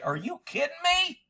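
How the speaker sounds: usual speaking voice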